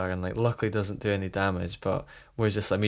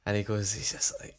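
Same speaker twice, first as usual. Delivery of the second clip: ordinary talk, nothing marked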